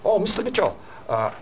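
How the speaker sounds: a hint of an eager Chinese attendee; Chinese woman. Trainer voicing a Chinese attendee asking him, high and eager. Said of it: "and said".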